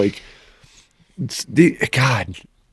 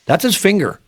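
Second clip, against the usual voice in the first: higher-pitched, insistent mock reassurance; imitated exasperated friend; 7 semitones higher than usual. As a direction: act out a friend correcting a panicking companion.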